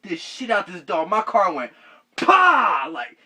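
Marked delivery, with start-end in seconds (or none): 2.2-2.9 loud high "pah" impact sound; loud crash noise. Retold or imitated. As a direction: punch out the crash. Imitates car hitting dog.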